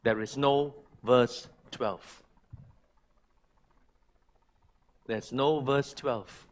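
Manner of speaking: There is no verse twelve, slow and falling, no stressed; slow emphatic. Shown as a demonstration. Pastor tells the church, slow emphatic There is no verse twelve. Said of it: "listen to what I'm trying to tell you now".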